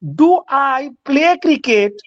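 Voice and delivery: stretched-out question, very slow; slow demo. Shown as a demonstration. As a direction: drag each word out. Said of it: "এবং যদি বলি". (খুব ধীরে টেনে বলা প্রশ্ন)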